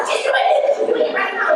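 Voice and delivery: very high, frantic mock tattling; tattling kid. Sister telling mom she's teasing, high urgent whine. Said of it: "be like".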